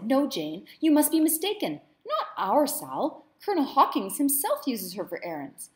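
Madam mimicking herself defending her slave; indignant retelling. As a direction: replay her own outrage. Madam reenacts her own protest.

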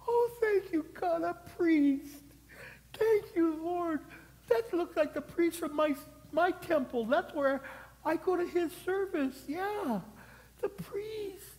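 very high, tearful falsetto relief; hammed-up wailing thanks of a wounded man; crying out. Beaten man spotting his priest, crying out in relief. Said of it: "And he's crying out".

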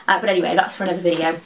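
quick brush-off aside; very fast talking. Real, not acted. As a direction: rattle it off quickly. Rushing past an aside.

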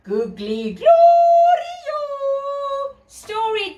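high, loud and slow show name; excited announcement. Acted. Host proudly calling out the show's name to kids. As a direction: announce it like a fanfare.

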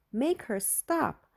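begging, stretching each word; frantic pleading. A narrator's reading. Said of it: "Julie went crazy".

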